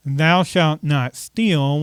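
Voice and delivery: ordinary delivery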